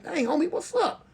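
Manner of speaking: very high questioning voice; concerned homeboy. Retold or imitated. Homeboy seeing his friend's tears, asking high. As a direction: imitate a startled friend asking. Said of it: "He's like".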